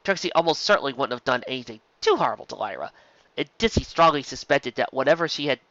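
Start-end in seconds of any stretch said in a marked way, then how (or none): none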